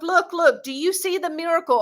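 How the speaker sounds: normal talking voice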